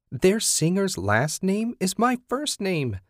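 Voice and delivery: high, slow, gushing squeal; giddy fan gushing about the singer. A narrator's reading. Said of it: "she'd squeal".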